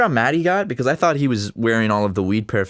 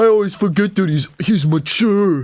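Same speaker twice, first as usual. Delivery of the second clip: high put-on mocking voice; exaggerated singsong mockery, louder; mocking repeat; 9 semitones higher, 6 dB louder than usual. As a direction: parrot the words back, pitched way up. Mocks own earlier words back.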